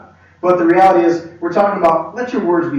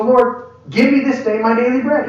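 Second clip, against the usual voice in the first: confident, higher-pitched petition; bold prayer; 6 semitones higher than usual. Acted. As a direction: ask as if it is already yours. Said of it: "come boldly".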